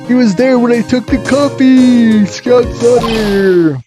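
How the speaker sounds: high, loud excited cry; excited high shout. Acted. Reading game dialogue aloud.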